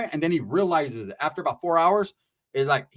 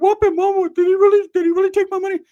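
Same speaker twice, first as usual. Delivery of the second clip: very high, loud, squeaky whine; high squeaky Ricky voice; 14 semitones higher, 9 dB louder than usual. Retold or imitated. Cousin Ricky whining to his mom in a squeaky voice. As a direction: squeak it out like a scared kid.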